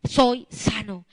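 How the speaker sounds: loud, forceful healing declaration; full-force preaching. Acted. Preacher modeling a declaration against sickness, loud and forceful. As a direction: drive each phrase out like a decree.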